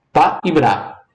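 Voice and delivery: ordinary delivery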